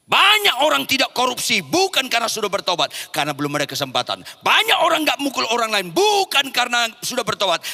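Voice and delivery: loud, driving pulpit delivery; forceful preaching. Acted. Preacher drives the point to the congregation, loudly. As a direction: hammer the point home.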